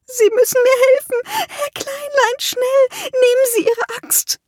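begging the dwarf to save her; terrified plea. A narrator's reading. Terrified therapist begs for help.

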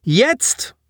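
high, sharp demand; yelled command. A narrator's reading. Boy pressing a soldier to act, yelled high. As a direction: demand it this instant. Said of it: "brüllte er ihm ins Gesicht".

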